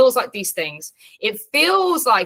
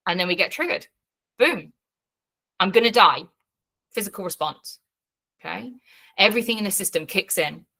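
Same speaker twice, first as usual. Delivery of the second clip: usual speaking voice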